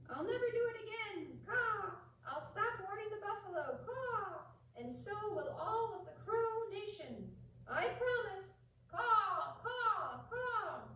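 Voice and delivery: high crow voice, promising; pleading crow. Acted. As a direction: beg like a scorched crow. Burnt crow pleading, flying away.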